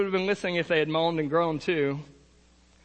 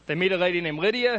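ordinary talk

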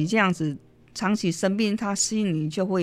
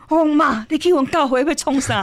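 surprised reply; 8 semitones higher, 7 dB louder than usual. Retold or imitated. Daughter surprised by mother's request.

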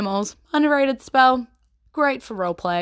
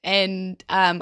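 normal talking voice

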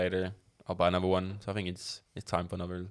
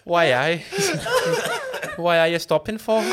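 mock Birmingham accent; 11 semitones higher, 6 dB louder than usual. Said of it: "with someone from Birmingham".

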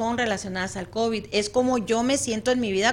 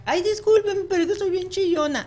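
much higher and louder, mock teary whine; imitating a crying client; 9 semitones higher, 5 dB louder than usual. Therapist imitates a tearful client in her office, in a mock whiny voice. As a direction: play the weepy patient apologizing for crying. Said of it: "empiezan a llorar".

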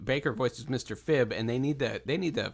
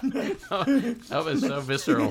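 laughing; 10 semitones higher, slower than usual. Real, not acted. Laughing at the creature sound.

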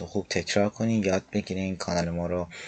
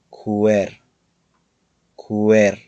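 کور said loud with rounded lips; کور stretched and loud with a round w; demo word; 8 dB louder, much slower than usual. Shown as a demonstration. Teacher models the word for students, loud and clear. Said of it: "کلمهٔ کور رو در نظر بگیرید".

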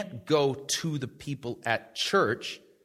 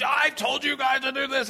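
high, exasperated scolding mother; exasperated mom; 11 semitones higher than usual. Retold or imitated. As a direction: scold the kids, fed up. Mom snapping at kids.

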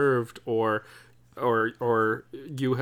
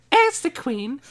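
much higher, louder regal posh voice; Queen impression; 10 semitones higher, 5 dB louder than usual. Host playing the Queen decides the next topic, much higher and regal. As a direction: announce it grandly as the monarch. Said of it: "We're doing impersonations".

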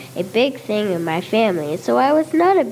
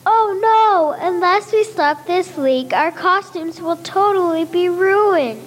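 alarmed; 8 semitones higher than usual. Acted. Alarm at sinking boat.

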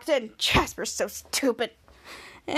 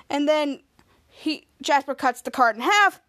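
ordinary delivery, nothing marked

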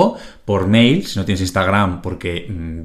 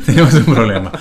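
laughing; 7 dB louder than usual. Real, not acted. Repeats line while laughing.

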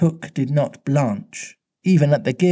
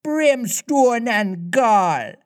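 high, drawn-out mimicked pirate oath; imitation pirate curse, pitched high; Hook; 9 semitones higher, much slower than usual. A narrator's reading. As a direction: retort in a mock captain's voice, stretched out. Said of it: "the voice retorted".

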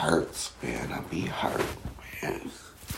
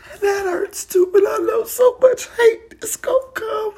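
sobbing, voice pitched high and louder; crying through it, half laughing; real crying; 8 dB louder than usual. Real, not acted. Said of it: "Now I'm crying 'cause I'm so vulnerable".

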